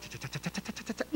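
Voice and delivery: whispered cha cha sound effect; breathy imitation of letters flicking past; soft flipping noise. Preacher miming letters flipping by, breathy.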